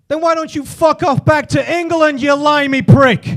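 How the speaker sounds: loud, high shout slowing on the insult; angry abuse. Acted. Man in line to the English customer, furious acted shout. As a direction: bellow the insult with full contempt.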